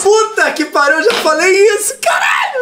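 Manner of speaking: thrilled outburst, pitch climbing; excited shout. Real, not acted. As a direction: shout it out in delighted agreement.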